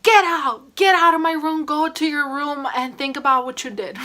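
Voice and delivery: furious mom. Retold or imitated. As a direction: throw her out of the room. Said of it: "mom was like".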